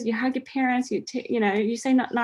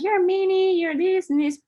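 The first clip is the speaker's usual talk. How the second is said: high, louder retold taunt; hammed-up accusation in a raised pitch; mock mean taunt; 10 semitones higher, 4 dB louder than usual. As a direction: imitate a name-calling teen, pitched way up. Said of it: "saying mean things to her".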